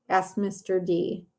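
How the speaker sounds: ordinary delivery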